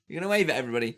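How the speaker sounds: ordinary talk, nothing marked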